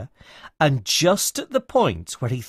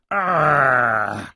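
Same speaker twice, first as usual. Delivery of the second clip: troll growl; 6 dB louder than usual. A narrator's reading. Troll woken on his bridge, grumbling growl. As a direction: growl it out, half asleep and cross. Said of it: "grumbled the troll".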